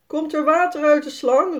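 dad calling outside to ask. A narrator's reading. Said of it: "roept Jaspers vader".

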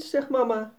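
ordinary delivery, nothing marked

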